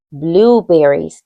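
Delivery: blueberries stretched out slowly and clearly; teacher models a new word for the children. Shown as a demonstration. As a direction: lay out blueberries slowly so they can copy it. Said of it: "Can you say blueberries?".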